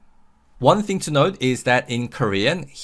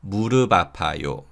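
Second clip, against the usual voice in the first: quicker linked demo; 2 semitones lower, much faster than usual. Shown as a demonstration. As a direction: say 무릎 아파요 briskly as "mu-reu-ba-pa-yo". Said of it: "unreleased sound that carries over".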